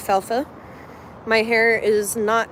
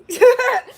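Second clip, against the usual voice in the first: laugh; 12 semitones higher, 6 dB louder than usual. Real, not acted. Flattered, laughs at compliment.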